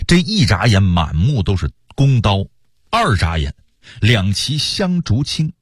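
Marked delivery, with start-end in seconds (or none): none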